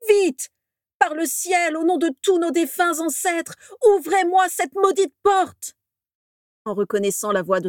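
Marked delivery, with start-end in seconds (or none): 0.1-5.8 high, loud, slowing frantic cries; desperate yelling. A narrator's reading. Orion yells at the manor door to be let in. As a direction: scream at them to open the door. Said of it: "hurlait-il à plein poumon".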